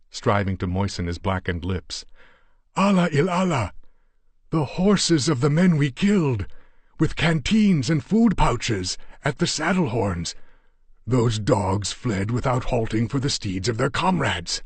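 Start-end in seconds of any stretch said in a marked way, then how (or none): 2.7-14.6 much higher and louder, exultant; excited Yar Ali. Acted. Yar Ali shows Steve the horses, exultant. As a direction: praise Allah, then rush the news.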